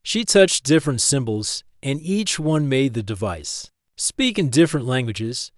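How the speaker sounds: usual speaking voice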